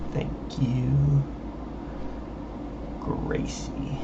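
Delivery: unvoiced whispered thanks; whispering. Real, not acted.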